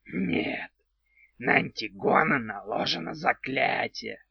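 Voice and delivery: odd creaky little house spirit; goblin voice. Acted. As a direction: play a quirky house goblin, slowly.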